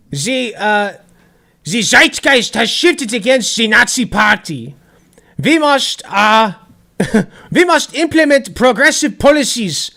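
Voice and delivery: bad German accent, slow and loud. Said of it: "I can't do a German accent. I'll do my best".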